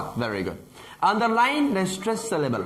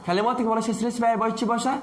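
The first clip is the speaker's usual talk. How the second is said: ordinary delivery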